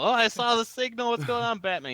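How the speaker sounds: high, winded mock panting; out of breath. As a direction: puff out the words. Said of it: "He's all out of breath".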